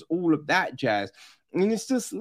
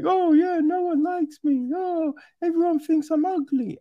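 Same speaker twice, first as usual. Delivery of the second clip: high, mocking girlish voice; mocking whiny girl; 11 semitones higher than usual. Mimicking the movie girl, high and whiny. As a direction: parody a self-pitying teen girl. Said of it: "this girl and she's like".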